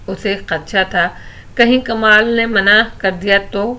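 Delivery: ordinary delivery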